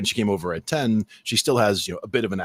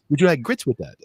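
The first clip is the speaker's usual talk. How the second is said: lightly imitated Southern waitress, pitched higher; mock Southern waitress; 6 semitones higher, 4 dB louder than usual. Waitress repeating the question to mom, light Southern accent. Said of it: "she said it perfectly clearly".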